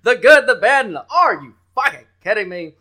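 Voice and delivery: loud, high hype-man shout. Acted. Host hyping free picks to listeners, loud. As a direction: pump the crowd up.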